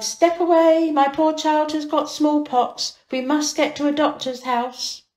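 high, loud warning cry; wife shouting to clear the street. A narrator's reading. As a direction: shoo the crowd away in alarm. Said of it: "and his wife cried".

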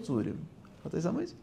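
ordinary talk, nothing marked

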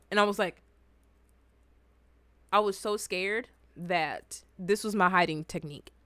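ordinary talk

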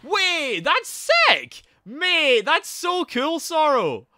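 high, loud burst of delight; real whooping enthusiasm at full volume; excited shouting. Real, not acted. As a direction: shout it, thrilled for a friend. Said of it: "Sorry for shouting".

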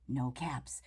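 dropped low and soft, disappointed; quiet no caps. Acted. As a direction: sink into a soft let-down murmur. Peddler finds no caps.

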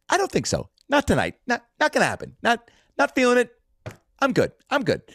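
imitating Larry turning down the stage; Larry David declining. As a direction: wave it off repeatedly.

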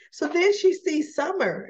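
ordinary talk

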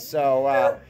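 normal talking voice

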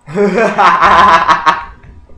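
loud burst of laughter. Real, not acted. Vlogger reacting to a stranger on chat, laughing loudly. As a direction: let out a long, loud cackling laugh.

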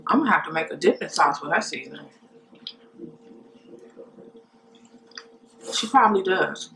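speaking with food in mouth; chewing between words; talking while eating. Real, not acted. Seen 0: Bites shrimp at lips, chews between words throughout labelled stretch.

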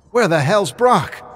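shouted high and loud; alarmed shout. Acted. Mahan shouting to the others for lost Brock, alarmed shout. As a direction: yell it in sudden panic.

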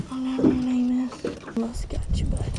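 unvoiced murmur to the fish; whispered aside. Real, not acted. Angler whispering to the fish in his hands. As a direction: whisper to the fish as you unhook it.

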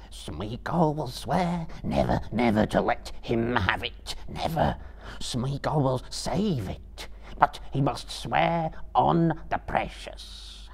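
slow, hoarse creature pleading; hoarse Gollum whisper. A narrator's reading. Gollum pleading at Frodo's feet, slow hoarse voice. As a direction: shudder out the oath slowly. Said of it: "whispering hoarsely".

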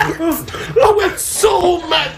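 high, very drawn-out laughing cry; real hysterical exclamation; laughing shout. Real, not acted. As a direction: stretch it out high through laughter. Laughing while reliving his mad moment.

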